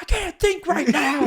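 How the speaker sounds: high, drawn-out flustered whine; mock panic. Hostage-taker put on the spot, mock panicked. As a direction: crack under pressure, high and slow. Acted gunman panicking under questions.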